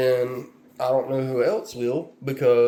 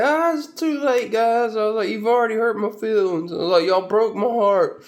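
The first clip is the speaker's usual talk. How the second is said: mock hurt; 9 semitones higher than usual. Mock hurt reply to kids.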